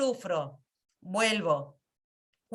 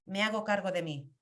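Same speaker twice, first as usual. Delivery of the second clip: usual speaking voice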